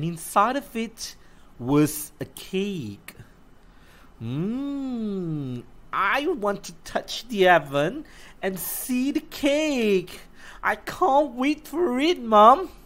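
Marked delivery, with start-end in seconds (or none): 4.2-12.7 put-on a higher, eager child's voice; lightly voiced little girl. Lucy pleading with her mom for cake, eager and higher. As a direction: sound like a keen small girl.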